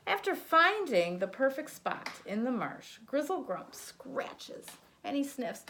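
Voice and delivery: put-on lively read-aloud for a child; sing-song picture book narration; storybook reading. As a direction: read it like bedtime story time.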